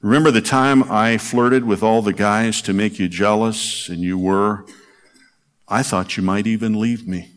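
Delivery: gentle recital of a poem; poem reading. Acted. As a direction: read it like a love letter to a lost husband. Reads a poem aloud.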